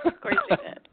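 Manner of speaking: high-pitched, laughing through the words; real amused laughter breaking into the reply. Real, not acted. Laughing at co-host's admission.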